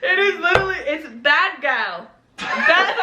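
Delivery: giggling through the words; laughing. Real, not acted. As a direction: laugh while you protest. Laughing after being teased.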